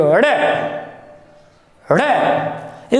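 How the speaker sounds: अड़े with the flap tossed out sharply; अड़े as a thrown, flapped sound; flung flap sound. Shown as a demonstration. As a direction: flick the tongue to fling out अड़े. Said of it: "उठाकर फेंक दिया गया".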